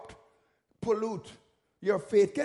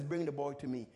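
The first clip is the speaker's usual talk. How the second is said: calm Jesus; 7 semitones lower, 6 dB quieter than usual. Retold or imitated. Jesus commands the boy brought.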